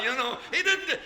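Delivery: high, loud amused preaching; excited laughing. Real, not acted. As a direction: laugh your way through it, delighted. Preacher laughing at donkey story.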